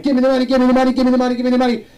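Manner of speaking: high, loud repeated demand; mock holdup shout; robber demand. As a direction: play a bank robber shouting orders. Bank robber demanding money.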